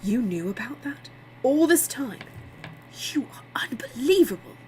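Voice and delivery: stunned disbelief turning to indignation; aghast. A narrator's reading. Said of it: "gaping at him".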